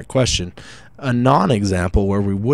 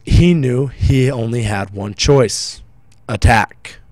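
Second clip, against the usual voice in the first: slow build, pause, then a loud attack; suspenseful build; 3 semitones higher, 6 dB louder, slower than usual. Shown as a demonstration. Teacher reads the dash example with suspense. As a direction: draw it out, then land hard on attack. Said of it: "helps build suspense".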